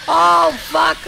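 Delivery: loud shocked swear. Real, not acted. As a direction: yell out in dismay. Enemies overwhelm player.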